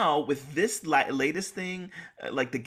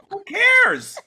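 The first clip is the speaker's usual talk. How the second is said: a louder, higher who cares; mock retort; 10 dB louder than usual. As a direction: snap back at him, exasperated. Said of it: "And I'm like".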